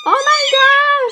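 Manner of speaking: oh my God jumping high; high exclamation. Real, not acted. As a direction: gasp it out. Kittens noisy, exclaims.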